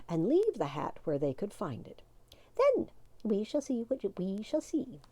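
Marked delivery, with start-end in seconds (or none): 2.6-5.0 knowing. A narrator's reading. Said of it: "said the wee one".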